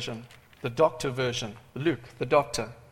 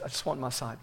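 soft, low, simple plea; humble request; 3 semitones lower, 7 dB quieter than usual. Acted. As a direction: drop to a quiet, modest ask. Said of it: "this guy, Bartimaeus, he says".

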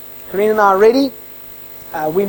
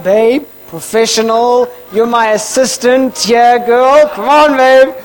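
loud, higher, drawn-out exasperated plea; playful scolding at raised pitch; mock chiding; 6 semitones higher, 7 dB louder, slower than usual. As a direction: urge a crying partner to act professional. Mock-chides crying wife at interview.

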